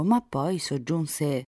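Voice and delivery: usual speaking voice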